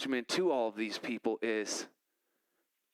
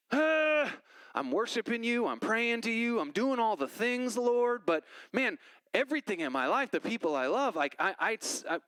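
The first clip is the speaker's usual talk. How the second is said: desperate plea to God; 8 semitones higher than usual. Retold or imitated. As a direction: cry out to God, worn out and pleading. Pleading to God in prayer.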